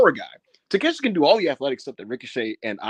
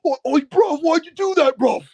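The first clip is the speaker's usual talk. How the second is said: very high, louder hurt whine; betrayed disbelief; 13 semitones higher, 5 dB louder than usual. Retold or imitated. Ospreay imitated begging his betrayer, very high and hurt. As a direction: plead like a stunned friend. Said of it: "Ospreay's looking at him like".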